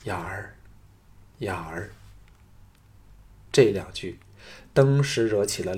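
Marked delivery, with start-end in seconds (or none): 0.0-1.9 softly murmured name, much softer; low murmur. A narrator's reading. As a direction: breathe the name close to the ear. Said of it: "凑到他耳旁低唤道".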